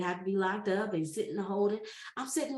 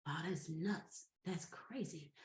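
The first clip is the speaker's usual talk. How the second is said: stunned hush; 5 semitones lower, 13 dB quieter, slower than usual. Retold or imitated. Stunned at getting jail client.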